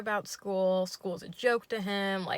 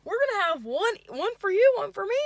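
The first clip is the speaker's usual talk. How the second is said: much higher, louder chirpy okay; high yay voice; 12 semitones higher, 8 dB louder than usual. Retold or imitated. Date agrees in a high goofy chirp. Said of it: "yay voice".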